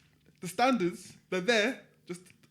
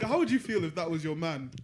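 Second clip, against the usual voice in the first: usual speaking voice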